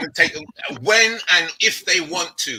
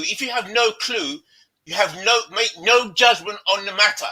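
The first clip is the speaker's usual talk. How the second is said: usual speaking voice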